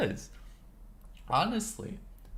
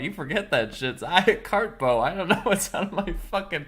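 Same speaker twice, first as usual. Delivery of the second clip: usual speaking voice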